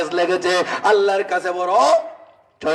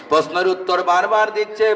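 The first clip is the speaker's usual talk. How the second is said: ordinary delivery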